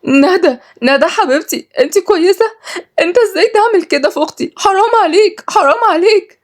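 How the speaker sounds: very high, distraught wail; crying. Acted. Malak runs to her fallen sister, crying. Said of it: "وهي بتقول بعياط".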